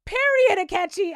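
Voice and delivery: high, loud Period, Akechi; hype cheer. Real, not acted. Vlogger cheering Akechi's comeback, high loud hype. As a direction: shout it like a win.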